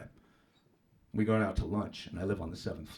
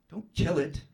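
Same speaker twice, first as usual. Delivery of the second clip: indignant; 7 semitones higher, 5 dB louder, slower than usual. Retold or imitated. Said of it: "She looks at me all, like, indignantly".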